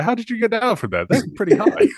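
high-pitched, incredulous questioning; amused incredulity. Acted. Asking the hero how he got down, amused incredulity. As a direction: pitch it right up in amused disbelief.

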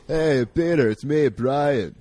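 a deliberately wrong Family Guy Brian; bad Brian impression. Joking about strange impressions, slow low mock Brian. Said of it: "all Family Guy voices".

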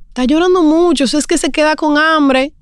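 high-pitched mimicry of meddling visitors; imitated fussing visitors. Visitors telling a new mother her baby is hungry, mimicked fussing.

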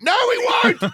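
high laughing shout of refusal; playful outraged no; mock shout. Refusing to stop for a drink.

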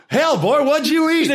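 high, loud mock astonishment; rowdy, high-pitched quoted outburst; hammed-up movie character. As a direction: holler it at the next stall over. Quoting Tom Arnold's cameo line.

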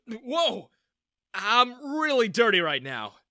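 high, loud startled exclaim. A narrator's reading. Young man exclaims as the mother hugs him, startled. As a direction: jump back in surprise. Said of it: "I exclaimed".

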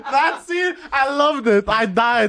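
high, loud, laughing delight. Real, not acted. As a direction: laugh through the words. Laughing excitedly about scene.

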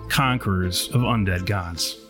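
normal talking voice

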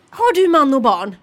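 mimicked old-lady interrogation; nosy old lady. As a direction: draw it out loudly like a prying old lady. Imitating nosy old lady's question.

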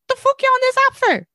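incredulous high-pitched rant; exasperated outburst. Retold or imitated. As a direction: squeal it out in disbelief. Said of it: "and I'm like".

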